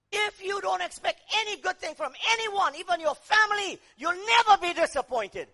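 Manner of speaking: loud, driving sermon cry; fiery preaching. Acted. Preacher shouting to the congregation, loud and driving. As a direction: hammer the power of the cross home.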